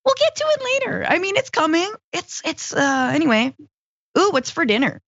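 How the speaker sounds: high-pitched, dithering brush-off voice; mock procrastinator. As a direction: put off the task, then get distracted in a high voice.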